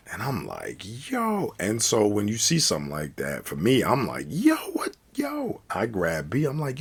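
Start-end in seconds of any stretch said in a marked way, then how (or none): 1.1-1.5 high-pitched, drawn-out shocked exclamation; mock astonishment; stunned reaction. 4.3-5.6 very high, stunned exclamation; acted-out shock at a sudden blow; shocked disbelief. Shocked at friend's sudden headbutt.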